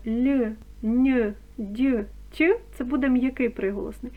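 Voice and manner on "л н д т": "л, н, д, т" each palatalized, as before ь; clear soft consonants. Shown as a demonstration. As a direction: say "л, н, д, т" distinctly soft, one by one. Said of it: "якщо ми чітко вимовляємо". (чітко й м'яко: «л, н, д, т»)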